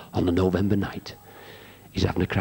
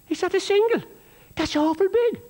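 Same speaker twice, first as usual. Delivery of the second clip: Texan barman voice. Retold or imitated. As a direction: voice the Texas barman, high and amazed. Texan barman quoted in joke.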